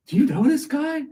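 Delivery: passerby spotting the fallen man, high put-on voice. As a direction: squeak it like a nosy stranger. Passerby asking about fallen man.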